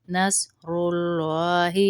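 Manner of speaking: نصر with a thick ص, never نس; slow نصر, heavy ص and heavy lam; heavy saad. Shown as a demonstration. Teacher shows نصر against the wrong نس. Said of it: "نس نہیں پڑھیں گے۔".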